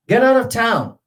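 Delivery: "Get out of town" high and loud, stress on "out"; sheriff's shout, first part high. Shown as a demonstration. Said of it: "the first part is higher".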